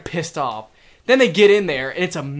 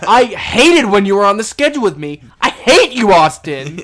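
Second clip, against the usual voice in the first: emphatic rant; 3 semitones higher, 9 dB louder than usual. Real, not acted. Venting at the coworker she wants to hear this, loud rant. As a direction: hurl each word at him, loud and forceful. Mock tirade at absent coworker.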